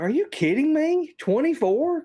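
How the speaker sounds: high, loud disbelieving squeak; mock outraged disbelief; incredulous. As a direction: shriek it in total disbelief. Incredulous retort to provider offer.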